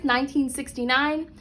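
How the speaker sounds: plain reading voice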